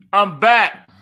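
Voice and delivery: loud, high comeback cry; triumphant announcement. Retold or imitated. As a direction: proclaim it like a returning champion. Acts out his triumphant return.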